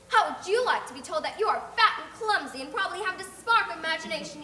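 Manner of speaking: loud, high, indignant tirade; furious child. Acted. Insulted girl raging at the neighbour, loud and indignant. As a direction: shout down the rude woman.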